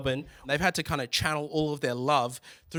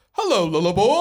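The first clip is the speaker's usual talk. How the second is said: loud, booming Yogi Bear-like dad; exaggerated impression of a Sri Lankan dad; intense dad; 5 semitones higher, 9 dB louder than usual. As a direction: greet your child at full intensity, swooping up at the end. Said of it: "the same level of intensity, just, like, a different frequency".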